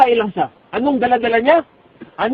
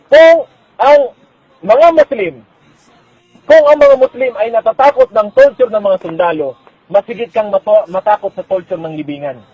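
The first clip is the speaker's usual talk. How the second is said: loud, raised sermon warning; fiery preaching; 2 semitones higher, 9 dB louder than usual. Acted. Preacher warns the brothers of the grave's torment, loud and fiery. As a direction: hammer the warning home.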